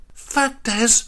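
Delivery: high, sharp spat-out curse. A narrator's reading. Greta face down in mud spits at Des. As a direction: spit the words out. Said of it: "she spat".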